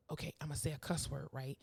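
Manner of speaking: hushed, soft secret plan; conspiratorial child. Retold or imitated. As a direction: reenact a kid plotting under their breath. Child secretly planning to swear.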